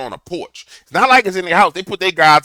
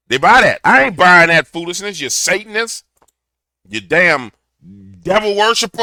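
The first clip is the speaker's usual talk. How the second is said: louder, higher hammed-up rant; mock ranting; 5 semitones higher, 7 dB louder than usual. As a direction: rail at the devil half clowning. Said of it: "Let me stop clowning".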